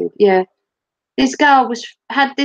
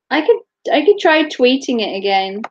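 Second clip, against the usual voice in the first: usual speaking voice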